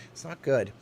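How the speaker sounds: usual speaking voice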